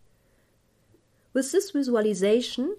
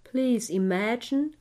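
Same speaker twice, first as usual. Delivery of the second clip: guided meditation. Acted. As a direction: guide the listener gently into calm.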